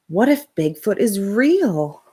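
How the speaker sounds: usual speaking voice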